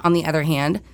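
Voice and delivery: ordinary talk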